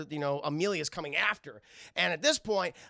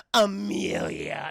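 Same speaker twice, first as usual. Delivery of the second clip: sarcastic; 3 semitones higher, 6 dB louder, much slower than usual. Acted. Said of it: "so sarcastically".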